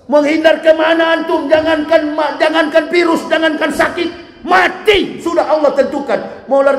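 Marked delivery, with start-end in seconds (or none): none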